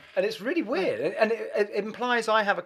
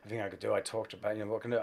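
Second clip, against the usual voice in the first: much lower, much softer sorry tone; apologetic Pat; 8 semitones lower, 10 dB quieter, faster than usual. Retold or imitated. Pat calling to fire him, soft and apologetic. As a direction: break the bad news gently and sheepishly. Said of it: "he's going".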